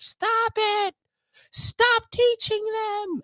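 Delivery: very high-pitched mock scolding; exasperated partner. Imagining the partner telling off the household, high mock scolding. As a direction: imitate a frazzled parent in falsetto. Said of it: "you're gonna be like".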